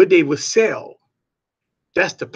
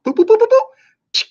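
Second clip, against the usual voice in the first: high beeping register scanner; register beeps; 5 dB louder than usual. Retold or imitated. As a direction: beep out each scan quickly.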